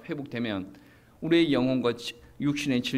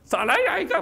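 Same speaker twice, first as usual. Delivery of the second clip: loud, high, blunt Gyeongsang dialect bark; Gyeongsang tough-guy accent; 6 dB louder than usual. Retold or imitated. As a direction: play a macho Gyeongsang man showing off on TV. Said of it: "경상도 사람들이 티비 나가지고".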